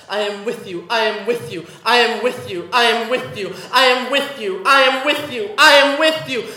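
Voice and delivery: I am with you swelling to a loud peak; building to loud. Acted. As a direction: grow it into a cry. Repeated line building.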